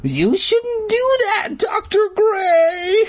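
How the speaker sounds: very high, drawn-out scream of protest. A narrator's reading. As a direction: scream at him, stretching every word. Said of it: "I screamed".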